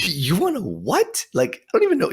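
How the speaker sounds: high, incredulous mom voice; baffled mom. Retold or imitated. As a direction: climb into squeaky disbelief as the mom. Baffled mom reacts to request.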